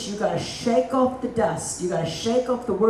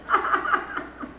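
laugh; 11 semitones higher, 4 dB quieter than usual. Real, not acted. Teasing aside about David.

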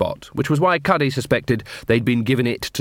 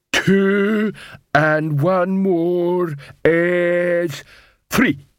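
very slow, raised-pitch troll sum; struggling troll; 7 semitones higher, much slower than usual. Acted. Troll works out calculus territory, slow laboured counting. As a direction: drag out each word of the sum. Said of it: "Detritus looked panicky".

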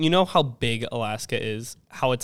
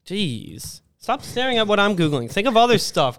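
defensive; 6 semitones higher than usual. Real, not acted. Defensive about being watched Googling.

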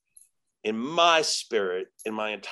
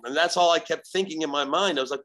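ordinary talk, nothing marked

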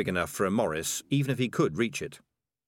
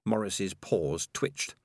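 ordinary delivery, nothing marked

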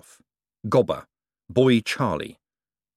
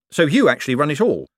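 quick; 6 semitones higher, 5 dB louder than usual. Acted. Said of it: "said Moist quickly".

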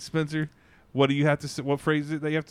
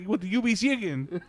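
put-on higher, insistent Mexican-accented question; thick Mexican accent; 6 semitones higher than usual. Retold worker repeating the chicken question, thick Mexican accent. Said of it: "the guy has a thick accent".

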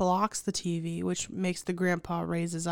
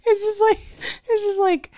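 high loud exasperated squeal; shrill disbelief; 13 semitones higher, 9 dB louder than usual. Real, not acted.

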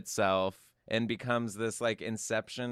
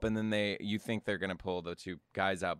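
ordinary delivery

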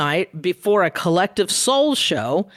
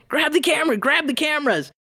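urgent call; 6 semitones higher than usual. Retold or imitated. Coworkers urgently shouting.

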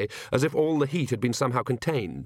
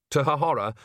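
plain reading voice

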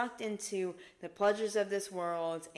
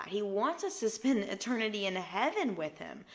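usual speaking voice

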